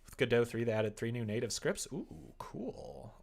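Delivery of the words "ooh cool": ordinary talk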